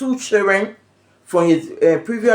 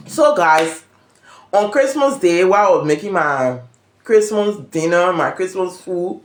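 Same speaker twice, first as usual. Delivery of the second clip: normal talking voice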